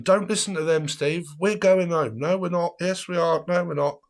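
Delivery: put-on high bickering drunk voices; mock squabble. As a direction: play the drunk argument. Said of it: "people shouting and screaming at me".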